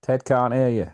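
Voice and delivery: mock call-out. Quoting a sitcom line.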